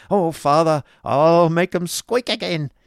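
put-on loud, high, drawn-out boyish glee; exclaiming child. A narrator's reading. Child grasping father's cane, gleeful exclamation. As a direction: crow like an excited boy. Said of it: "exclaimed the interesting child".

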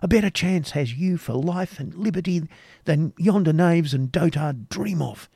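a passionate knight tempting her to flee with him; animated. A narrator's reading. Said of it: "proceeding with animation".

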